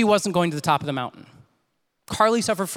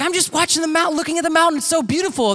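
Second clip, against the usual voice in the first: higher-pitched, lightly voiced girl in wonder; imitated delighted student; 8 semitones higher than usual. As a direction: quote her in a raised, wistful pitch. Voices Carly gazing at mountain.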